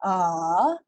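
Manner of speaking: a dipping low then rising; third tone demo. Shown as a demonstration. Teacher demonstrating dipping tone three. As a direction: scoop a down and back up. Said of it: "dia slow sikit depan tu".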